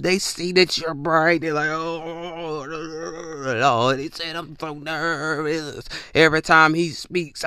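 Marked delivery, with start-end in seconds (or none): 1.7-5.9 mock nervous drawl, stretched out. Nervous people reacting to your aura, long mock-nervous wail. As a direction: softly draw the words out as if overwhelmed. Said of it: "They get nervous".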